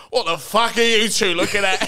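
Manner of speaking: loud, high Cockney gangster snarl; thick Cockney accent. Retold or imitated. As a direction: bark it like a London hard man. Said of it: "in the most thick Cockney accent goes".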